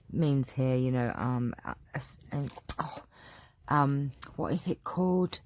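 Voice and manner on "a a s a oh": half-whispered fumbling for a word; unvoiced trailing searching for the term. Real, not acted. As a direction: breathe the fumbled sounds as you search your memory. Groping for a word.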